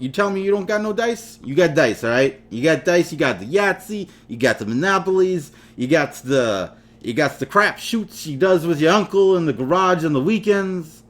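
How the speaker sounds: exaggerated New York accent, badly faked; terrible New York accent. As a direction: lay on a bad, pushy New York accent. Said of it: "terrible New York accent".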